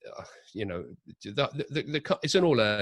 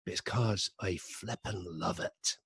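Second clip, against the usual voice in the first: put-on slow, softer Glaswegian accent, lightly done; 3 semitones lower, 6 dB quieter, slower than usual. Quoting the Glaswegian actor's delight at the coffee break, in a gentle Glaswegian accent. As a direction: quote the Glaswegian actor in a mild Glaswegian accent, unhurried. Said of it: "because he is Glaswegian".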